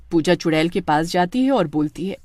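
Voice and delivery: ordinary delivery, nothing marked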